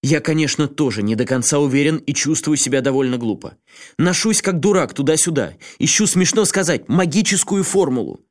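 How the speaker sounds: high, heated pleading rant; worked up. A narrator's reading. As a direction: build the argument into fury. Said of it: "разошёлся не на шутку". (разгорячённо, всё громче)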